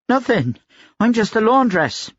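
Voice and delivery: high-pitched, scared laundress; frightened denial. A narrator's reading. Laundress gripped by the chin denies it, frightened and high.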